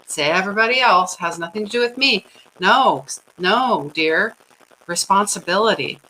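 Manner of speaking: ordinary talk, nothing marked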